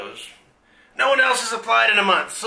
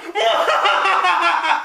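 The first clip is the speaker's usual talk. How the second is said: hammy villain cackle; evil laugh; 8 semitones higher, 3 dB louder than usual. Acted. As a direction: cackle over the scheme.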